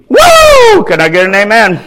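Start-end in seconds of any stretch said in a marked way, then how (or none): 0.1-0.8 high loud whoop; preacher's whoop. Real, not acted. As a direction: let out a big joyful whoop. Preacher's shout.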